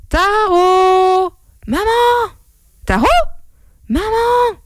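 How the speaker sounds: very high, drawn-out calls; calling from afar. Acted. Mother and son calling to each other, high distant calls. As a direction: call out across a great distance.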